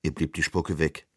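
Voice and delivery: plain reading voice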